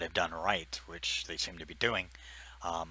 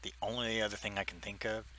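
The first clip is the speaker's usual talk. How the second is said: ordinary talk, nothing marked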